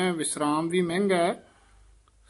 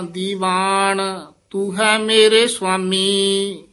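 slow, drawn-out chanted reading; slow devotional chant; Gurbani recitation; 3 semitones higher, 5 dB louder, slower than usual. Acted. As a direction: intone each line like scripture. Chants the hymn slowly.

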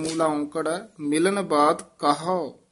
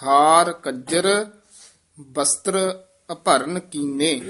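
slow and louder, weighting ਹਾਰ and ਬਸਤਰ; slow model recitation; 4 dB louder, slower than usual. Shown as a demonstration. Teacher models the whole line slowly for a student. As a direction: recite it slowly, giving every word weight. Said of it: "teacher recites the corrected line".

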